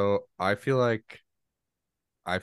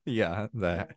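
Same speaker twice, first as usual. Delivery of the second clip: normal talking voice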